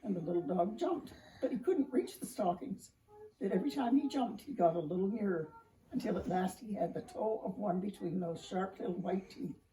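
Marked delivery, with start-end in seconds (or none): none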